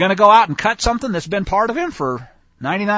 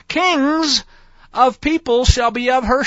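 higher, drawn-out joyful announcement; excited proclaiming; 5 semitones higher, slower than usual. Acted. God proclaiming to Abraham, excited and grand. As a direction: savor each word of the promise.

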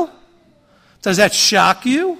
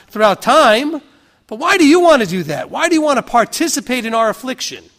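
higher, insistent, wondering why; puzzled questioner; 4 semitones higher than usual. Retold or imitated. [name] voiced questioning believers.

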